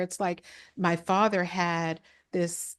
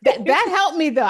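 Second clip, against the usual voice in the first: laughing while talking; 10 semitones higher, 10 dB louder than usual. Real, not acted. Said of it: "I can't stop laughing".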